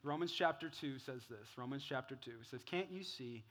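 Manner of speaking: low, hushed, earnest sermon voice; soft closing preaching. Real, not acted. Pastor closing with Romans on God's kindness, softly earnest.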